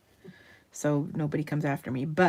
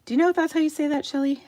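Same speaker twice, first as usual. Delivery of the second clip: higher, fast call to someone across the room; asking Shelley across the room, raised and quick; 9 semitones higher, 5 dB louder, much faster than usual. Real, not acted.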